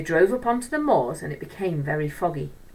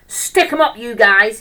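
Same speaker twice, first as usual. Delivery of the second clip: bellowed threat, much higher and louder; schoolboy bully roaring a stick-up on stage; 4 semitones higher, 12 dB louder than usual. Acted. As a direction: roar it like a gangster holding up a stage. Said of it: "he roared".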